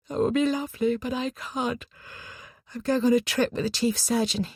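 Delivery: high, yawn-stretched weary voice. A narrator's reading. Eliza declines her friend's offer of rest, yawning. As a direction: turn it down through an acted yawn. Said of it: "Eliza yawned but shook her head".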